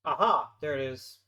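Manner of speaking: triumphant loud aha; sudden cry of discovery. Real, not acted. Finds hidden setting after long search.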